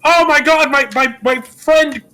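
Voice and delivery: high, stammering, excited movie-star voice, halting and loud; stammering old-movie hero. Retold or imitated. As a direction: trip over the words like a flustered classic film hero. George retold, stammering at the cop.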